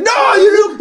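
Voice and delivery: high-pitched mock shout; high, frantic retort; exasperated yell. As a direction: holler it as a fed-up imitation. Acted exasperated reply, shouted.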